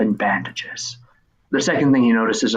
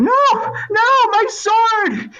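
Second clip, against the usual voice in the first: much higher and louder, dismayed cry; a kid losing his toy sword; boy wailing; 4 dB louder, much slower than usual. Acted. Boy crying out as his sword falls. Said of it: "he's like".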